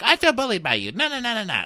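very high mocking wail; mocking Nicole's hurt protest, very high; whiny high mimic. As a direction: squeal it like a wounded child. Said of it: "Nicole's like".

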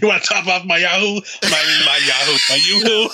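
hammed-up mobster hitting on a waitress; flirty goomba. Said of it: "You fucking goomba".

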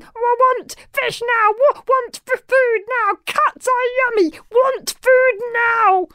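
very high, squealing creature tantrum; high-pitched stammering dragon clamour; shouting baby dragon. A narrator's reading. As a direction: squeal demands like a wildly struggling little dragon. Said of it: "struggled wildly, shouting".

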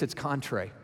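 usual speaking voice

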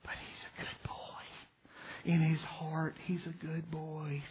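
soft whispered mother, slow at the end; pleading mom whisper. Retold or imitated. A mother whispers to the judge, pleading for her son. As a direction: plead softly like a doting mom. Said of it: "your mom walks up and goes".

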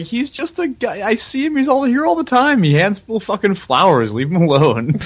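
high, pleading imitation of Carla; high-pitched Carla voice. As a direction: lift way up into a defensive friend. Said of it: "Carla's like".

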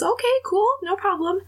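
high-pitched, easygoing backing off; placating retold reply. Man backs off in retold exchange.

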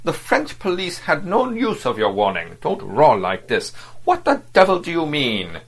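a snappish, vexed rebuke; irritated diplomat. Acted. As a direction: scold him for roaring. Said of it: "with a frowning grimace".

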